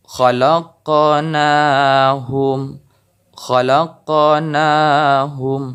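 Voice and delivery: very slow "khalaqnahum" with the q in the middle bouncing; qalqalah on qaf. Shown as a demonstration. Teacher demonstrating qalqalah, slow bounced qaf. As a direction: stretch "khalaqnahum" and let the qaf rebound. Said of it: "harus terdengar pantulan".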